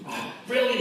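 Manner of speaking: put-on high, boastful little boy voice; proud kid. Young Stevie boasting to his dad, high kid voice. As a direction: crow like a proud schoolboy. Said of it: "he said".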